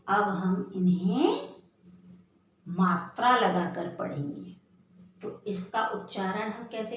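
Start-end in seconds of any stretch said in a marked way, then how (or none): none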